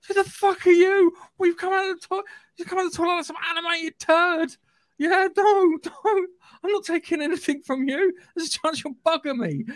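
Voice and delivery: high-pitched appalled disbelief; hammed-up horrified refusal; incredulous mock retort. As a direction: recoil from a weird stranger, voice way up. Mocking reply to toilet Morpheus.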